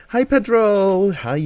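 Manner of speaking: high, loud drawn-out hello; excited greeting. Real, not acted. Streamer spots Pedro arriving in chat, excited greeting. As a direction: squeal a long, delighted hi.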